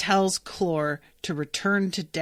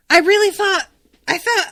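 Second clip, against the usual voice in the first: much higher and louder, indignant; worked up; 11 semitones higher, 8 dB louder than usual. Real, not acted. Reader ranting to viewers about the chapter ending, loud indignant. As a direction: protest like a fan let down by the book.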